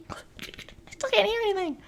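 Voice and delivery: put-on high, soft whispered kid voice; high-pitched whisper. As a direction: whisper like a disappointed little boy.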